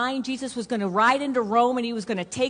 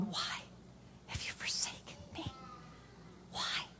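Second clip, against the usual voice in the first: barely audible, broken-up whisper with long pauses; Jesus whispering; 2 semitones higher, 18 dB quieter than usual. Acted. Preacher voicing Jesus forsaken on the cross, a hushed halting whisper. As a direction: whisper it with hardly any voice, halting between phrases. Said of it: "I always picture him going".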